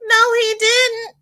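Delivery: high, loud, drawn-out mock disbelief; scandalized reaction. Host replaying her reaction to the news, scandalized. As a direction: squeal it slowly, hammed-up shock. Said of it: "I've been sitting here going".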